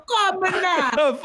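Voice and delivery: put-on high, slow Caribbean accent; drawn-out Caribbean imitation, laughing; mock Caribbean accent. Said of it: "read that in that accent".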